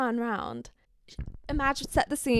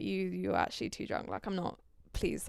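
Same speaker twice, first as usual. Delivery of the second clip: soft, lower pleading; quiet plea; 3 semitones lower, 7 dB quieter than usual. Retold or imitated. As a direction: quietly ask him to stop. Pushing him off gently.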